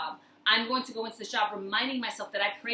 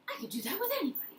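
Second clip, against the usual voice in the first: higher, fast and bright repeat; quick, higher excited restatement; excited emphasis; 8 semitones higher, 6 dB quieter, much faster than usual. Real, not acted. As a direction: say it again, thrilled. Excited repeat of own point.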